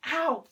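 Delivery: sudden high, loud "ow"; quick real cry of pain; pained yelp. Real, not acted. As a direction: yelp as if you just hurt yourself. Hurts self while kneeling to draw.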